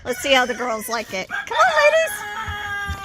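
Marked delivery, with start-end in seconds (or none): 1.3-3.1 slow, high drawn-out call; high, slow call to the hens; calling chickens. Real, not acted.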